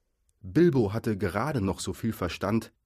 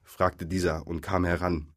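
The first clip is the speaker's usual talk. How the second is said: ordinary delivery, nothing marked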